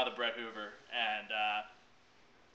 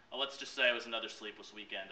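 ordinary delivery

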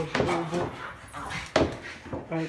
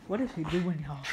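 ordinary delivery

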